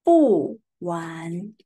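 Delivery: 不玩 with falling 不 and rising 玩; bu wan demo. Shown as a demonstration. Teacher modelling 不玩 for students. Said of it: "we pronounce".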